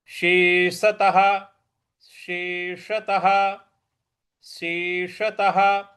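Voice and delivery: शेषतः slowly, retroflex ष, final ह; slow careful recitation. Shown as a demonstration. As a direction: spell out शेषतः syllable by syllable. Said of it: "सलजम वाला श ... षटकोण वाला श". (धीरे धीरे शेषतः)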